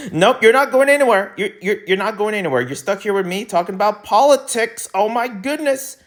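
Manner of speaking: high-pitched mock insistence; playful insistence. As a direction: pitch it way up, teasingly refusing to let them leave. Playfully holding viewers hostage for politics.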